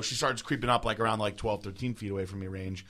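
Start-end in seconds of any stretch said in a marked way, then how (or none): none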